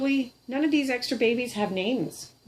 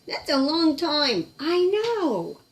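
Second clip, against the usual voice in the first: puppet voice; 6 semitones higher, 4 dB louder, slower than usual. Pretend. Puppet answers in voice.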